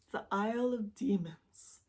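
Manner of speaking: ordinary talk, nothing marked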